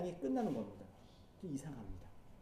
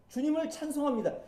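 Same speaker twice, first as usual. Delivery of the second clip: 주님을 찬송합니다 said higher, ringing out; joyful praise; 6 semitones higher than usual. Shown as a demonstration. Preacher showing the hoped-for ending, higher and bright. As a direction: sing out 주님을 찬송합니다 as a triumphant close. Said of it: "이렇게 끝나면 너무 좋겠는데".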